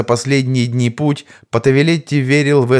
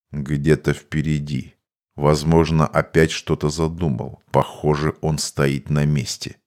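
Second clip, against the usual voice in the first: low, softened mage voice; composed mage; 7 semitones lower, 4 dB quieter than usual. Acted. Mage tells the commander where the fugitive is, composed and low. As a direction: report it low and unhurried, guessing as you go.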